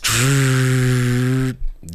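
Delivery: long steady static hiss with the mouth; drawn-out buzzy static sound. Retold or imitated.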